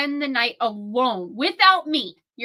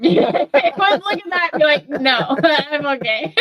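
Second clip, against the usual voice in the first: laughing through a mock polite refusal; 3 semitones higher, 7 dB louder than usual. Real, not acted. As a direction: giggle it out while speaking. Laughing while voicing the spirit.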